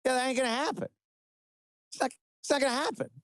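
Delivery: mock Trump. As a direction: do a dismissive hammed-up Trump brushing off the deal. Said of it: "he's just like".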